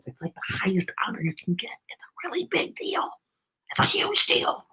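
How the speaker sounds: unvoiced hush, leaning in close; whisper. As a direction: whisper it like a secret to the kids. Reader confides an aside.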